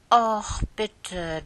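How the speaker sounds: hoch und laut bettelndes „Och“; drängelnd. Acted. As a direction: quengelnd um etwas bitten. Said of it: "drängelte Lieschen ein bisschen". (Lieschen bettelt die Tante an)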